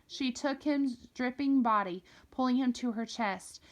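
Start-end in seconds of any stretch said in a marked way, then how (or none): none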